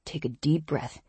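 soft, smooth and reassuring; calm, soothing. Acted. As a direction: soothe her gently. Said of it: "Ezra's level voice, meltingly smooth".

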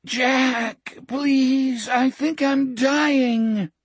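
put-on high, slow, weak groaning boy; groaning dying boy. A narrator's reading. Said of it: "Richard's groaning, helpless voice".

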